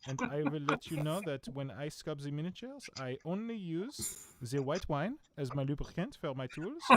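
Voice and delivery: playful fake French accent; hammy French snob voice. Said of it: "my weird French accents".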